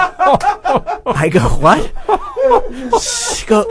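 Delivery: high-pitched laughing through the words; can't stop laughing while telling it; real helpless laughter. Real, not acted.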